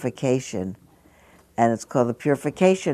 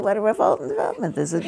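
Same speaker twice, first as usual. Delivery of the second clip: calm tone; 7 semitones higher, 3 dB quieter, much faster than usual. Shown as a demonstration. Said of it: "in a very calm tone of voice".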